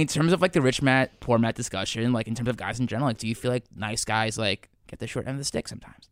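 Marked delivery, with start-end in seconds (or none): none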